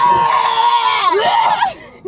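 drawn-out high loud shriek; scream. Real, not acted. Rider at the driver's prompting, drawn-out scream. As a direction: let out a big high scream.

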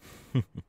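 brief staccato laugh; parting chuckle. Acted. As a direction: give a short chuckle after the goodbye. Character chuckles after saying farewell.

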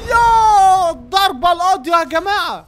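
loud, slow, drawn-out hype call; excited shout. Real, not acted. Gamer telling viewers about the knockout, loud slow hype. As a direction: announce the knockout to the crowd.

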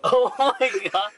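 high laughing oh my God; excited squeal. Real, not acted. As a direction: squeal it out in disbelief. Laughing at parking spot.

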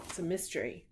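ordinary delivery

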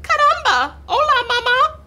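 very high, loud squeaky greeting; baby chick. A narrator's reading. Newly hatched chick greeting the cat, excited baby voice. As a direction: chirp it way up high as a newborn. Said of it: "looked up at Jack and said".

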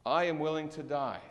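ordinary delivery

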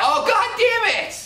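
very high, slow drawn-out cry; exasperated outburst. Real, not acted. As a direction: wail it in frustration. Frustrated by a game mishap.

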